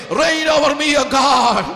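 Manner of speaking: loud call. Shown as a demonstration. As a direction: ring it out over the crowd. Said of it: "ഒച്ചത്തിൽ പറ".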